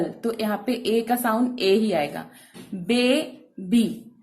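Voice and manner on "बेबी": "बेबी" slowly split, बे then बी; syllable break. Shown as a demonstration. Teacher gives an example of long A, "बेबी" slowly split. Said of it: "अगर वो break हो जाता है".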